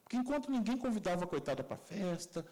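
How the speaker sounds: ordinary delivery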